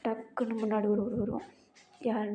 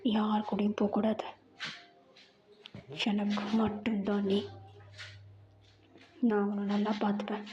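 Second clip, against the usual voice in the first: possessive lover. Acted. Jungkook voiced low, possessive. (தாழ்ந்த குரலில் உரிமை கொண்டாடும் காதலன்)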